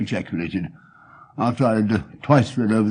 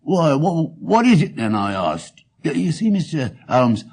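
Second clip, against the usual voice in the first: higher, louder voice of a chatty pawnbroker; eager pawnbroker; 7 semitones higher, 5 dB louder than usual. Acted. Pawnbroker telling Holmes about his shop, eager and chatty. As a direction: play the earnest London shopkeeper.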